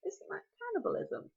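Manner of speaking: a hint of a curious young hero; young Hiccup. Hiccup asks the dragon, lightly voiced puzzled boy.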